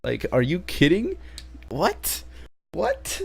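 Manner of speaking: a baffled fan yelping what; incredulous. Real, not acted. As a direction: voice shoots up high on What. Incredulous at grandpa recovering.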